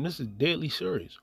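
ordinary talk, nothing marked

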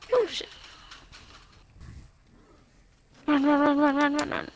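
panicked high run, run, run; fleeing zombies in a panic; being chased. Real, not acted.

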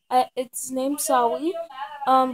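ordinary talk, nothing marked

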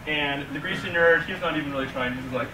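usual speaking voice

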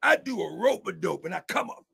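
ordinary talk, nothing marked

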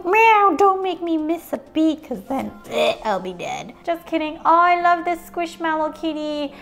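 usual speaking voice

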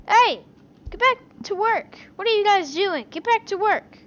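loud bossy yell; shouting at workers. Acted. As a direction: Get back to work, bark it out. Yelling at game workers.

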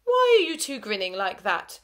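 teacher frowning at two grinning pupils. A narrator's reading. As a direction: sound suspicious of them. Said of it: "Missus McDonald frowned".

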